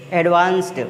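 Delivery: final -ed said as t; "advanced" ending in t. Shown as a demonstration. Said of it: "एडवांस्ड पढ़ेंगे". ("advanced" के अंत में ट की ध्वनि)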